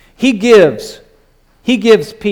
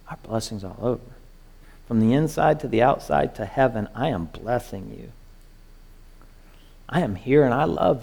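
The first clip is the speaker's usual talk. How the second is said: much softer, intimate and reassuring; God speaking tenderly; 3 semitones lower, 3 dB quieter, faster than usual. Acted. God reassuring his complaining child, soft and tender. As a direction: speak as a loving father holding a child. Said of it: "Because my God says".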